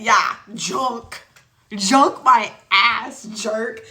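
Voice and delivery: loud, drawn-out offended rant; scandalized, with stretched emphatic words; indignant retort. Real, not acted. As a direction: throw the insult back, punching each item. Indignant retort to being called trash.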